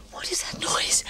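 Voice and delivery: scared, hushed question; frightened whisper. Acted. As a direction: whisper it as if hearing something at the door.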